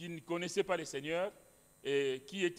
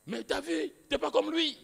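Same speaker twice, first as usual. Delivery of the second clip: much higher, louder cry of amazement; amazed exclamation; 10 semitones higher, 5 dB louder than usual. Retold or imitated. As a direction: exclaim in sudden wonder. Colleague amazed at the speaker. (exclamation étonnée, bien plus aiguë)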